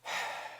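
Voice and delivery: imitated long, breathy sigh; long weary sigh. Postal worker sighing before breaking news to a customer, long sigh. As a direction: let out a long sigh, as the caller did. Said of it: "she lets out this long sigh".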